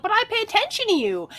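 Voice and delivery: high plaintive plea to a partner; mock hurt woman. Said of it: "And we're like".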